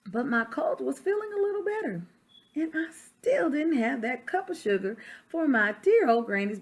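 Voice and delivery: reading a picture book aloud as the wolf; story narration. Acted. Teacher reading to children, storytelling voice. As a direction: tell it to the class like the wolf's own tale.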